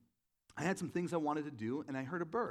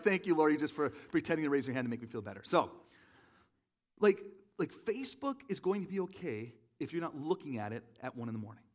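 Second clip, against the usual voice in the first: ordinary talk, nothing marked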